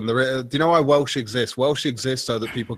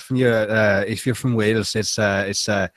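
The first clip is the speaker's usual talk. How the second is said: Welsh accent. Shown as a demonstration. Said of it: "How does it sound?".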